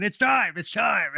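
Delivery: high, loud imitated scream; mock scream. Guest quoting the wrestler giving the cue, mock screaming. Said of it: "and then he's screaming".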